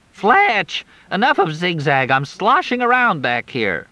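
put-on high teasing young woman's voice; Crystal.

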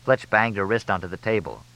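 plain reading voice

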